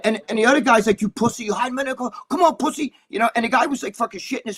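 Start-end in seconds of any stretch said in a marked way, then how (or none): 1.0-2.9 high, imitated goading from a rival; taunting challenge. Rival taunting the kid hiding behind cops, high aggressive mockery. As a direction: goad him to come out, pitched well up.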